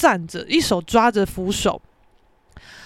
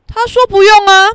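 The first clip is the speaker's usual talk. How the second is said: loud, raised-pitch retort; pointed retort; 8 semitones higher, 10 dB louder than usual. Retold or imitated. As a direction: throw it back at the old man. Triumphantly relaying answer to old man. (提高嗓门回呛)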